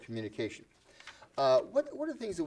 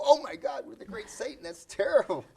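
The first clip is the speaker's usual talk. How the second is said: much higher, mock horror; mock shocked gasp; 12 semitones higher, 5 dB louder than usual. Americans reacting to being called the great Satan, mock horror. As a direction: ham up an outraged American. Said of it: "But we go".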